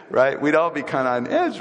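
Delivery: ordinary talk, nothing marked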